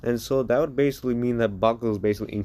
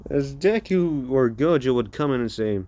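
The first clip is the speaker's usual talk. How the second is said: ordinary delivery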